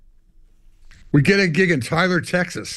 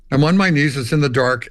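ordinary delivery

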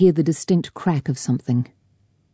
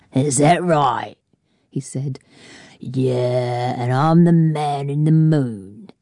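sneering bully; slower than usual. A narrator's reading. Fowler mocking disbelief.

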